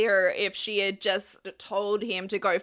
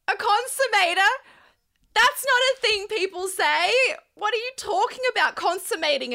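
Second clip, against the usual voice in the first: incredulous; 9 semitones higher, faster than usual. Real, not acted. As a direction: repeat his word as if it can't be real. Mocking disbelief at his word.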